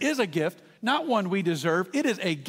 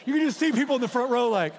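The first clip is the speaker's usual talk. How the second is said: ordinary talk, nothing marked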